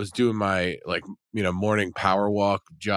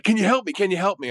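high, loud, panicked begging; frantic plea; 13 semitones higher, 5 dB louder, faster than usual. Retold or imitated. Stranger frantically begging a passing jogger for help, high and loud. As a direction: beg for help like a desperate stranger. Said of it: "and he's so frantic".

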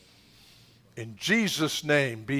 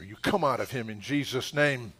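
normal talking voice

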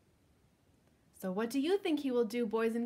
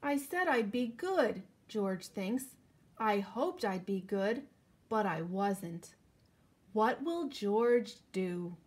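put-on slow, lively storybook reading for kids; reading to children; 2 semitones higher, 5 dB louder, slower than usual. A narrator's reading. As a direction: read it out like story time for little ones. Reading picture book to children.

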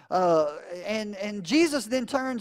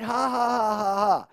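mock laugh; 3 semitones higher than usual. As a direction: fake sarcastic ha ha ha. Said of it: "Jesus isn't really laughing".

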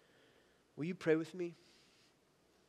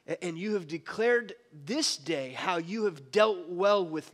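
scripture reading; 3 dB louder than usual. Acted. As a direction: read it as a king's humbled reply. Reads Saul's words from scripture.

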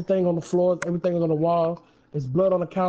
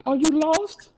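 put-on little boy; 9 semitones higher than usual. Boy voiced in story.

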